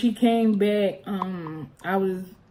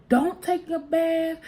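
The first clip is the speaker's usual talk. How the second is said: mom's slow warning; 8 semitones higher, slower than usual. Retold or imitated. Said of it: "She said".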